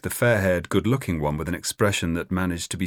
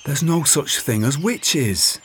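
weary sigh; 9 semitones higher, 3 dB louder, slower than usual. Acted. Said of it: "Robin sighed".

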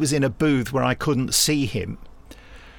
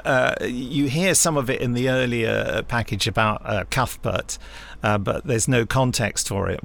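ordinary delivery

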